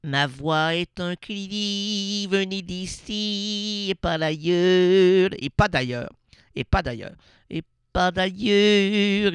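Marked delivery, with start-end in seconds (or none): 0.0-5.3 slow mock cry. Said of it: "Même si je crie". 7.5-9.3 et pas d'ailleurs stretched out slowly and loudly; slow drawn-out cry. Shown as a demonstration. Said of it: "Même si je crie".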